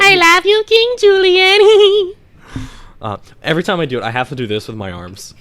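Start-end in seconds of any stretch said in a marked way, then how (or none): 0.0-2.1 very high, very slow squeaky lemur; Mort from Madagascar. Shown as a demonstration. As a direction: drag out King Julian, way up high. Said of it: "Yeah, the same".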